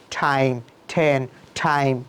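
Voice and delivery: slow "টাইম, ঠেন, টাইম", each starting like ঠ; aspirated T. Shown as a demonstration. Teacher drilling T, slow aspirated "টাইম, ঠেন, টাইম". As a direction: puff every t in "টাইম, ঠেন, টাইম". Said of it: "ঠয়ের মতো".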